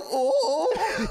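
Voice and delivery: high yelping oh, oh; imitating Marty's oh. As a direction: yelp it like a scared dog. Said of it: "you sound like an old stupid dog".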